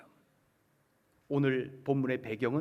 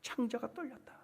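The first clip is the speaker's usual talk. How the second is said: high, much softer echo; soft, raised-pitch lament; hushed anguish; 6 semitones higher, 10 dB quieter than usual. Acted. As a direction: let the quote sink in, hushed. Quote repeated in a hushed voice.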